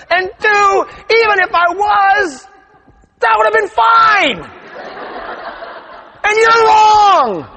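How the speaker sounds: high and loud, building to a shout; worked-up rant. Retold or imitated. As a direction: vent the indignation, climbing to a yell. Retelling her outraged inner rant.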